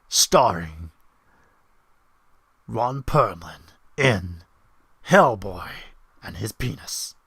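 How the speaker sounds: deep, slow trailer announcer; movie trailer voiceover. Pretend. Streamer showing off his porn voiceover, trailer voice. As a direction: announce it like a big film trailer. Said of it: "I do voiceovers for porn".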